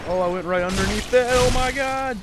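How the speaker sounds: loud, high and fast exclamation; excited outcry. Real, not acted. Gamer slipping under an attack, loud high shocked outcry. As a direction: blurt it out in shock. Gamer startled by near miss.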